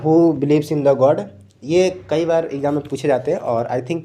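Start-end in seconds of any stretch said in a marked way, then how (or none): 0.0-1.2 slow emphatic read-out. Shown as a demonstration. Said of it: "ये जो question था ना".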